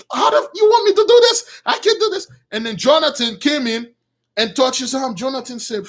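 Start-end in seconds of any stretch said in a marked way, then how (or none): 0.1-2.3 high, exasperated cursing outburst; angry cursing. Acted. Saul refusing what is asked of him, cursing angrily. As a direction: rage like a king who can't take it. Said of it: "Saul was cursing".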